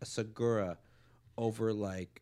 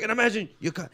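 laughing Diaz riff; 9 semitones higher, 5 dB louder than usual. Retold or imitated.

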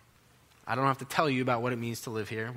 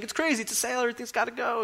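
high-pitched, giddy salesman; imitating an excited bargain pitch; all excited; 9 semitones higher, faster than usual. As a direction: pitch it like a clearance sale. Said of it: "he's all excited".

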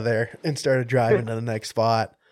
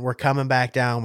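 ordinary talk, nothing marked

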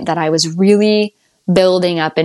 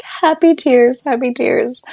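tearful reply; 5 semitones higher, slower than usual. Retold or imitated. Answering midwife while bawling.

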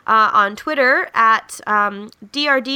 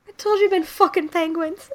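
retold exasperated punchline; 7 semitones higher than usual. Teller repeats the leprechaun's punchline.

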